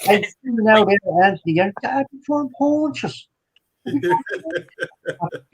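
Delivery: mock-threatening Irish voice; Irish accent. Threatening Anthony with punches for laughs, Irish accent. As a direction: put on a high Irish lilt. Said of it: "That's Irish".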